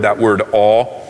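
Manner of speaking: all drawn out slow and heavy; stress on all. Shown as a demonstration. Preacher dwelling on the word all. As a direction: lean hard on the word all. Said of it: "That's a big word".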